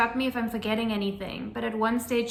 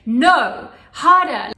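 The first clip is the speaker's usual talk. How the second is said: much louder, sharp no, harder; stern demand; 3 semitones higher, 12 dB louder, much slower than usual. Retold or imitated. Midwife demanding a harder push, loud and stern. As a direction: demand more effort. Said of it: "She was, like, really serious".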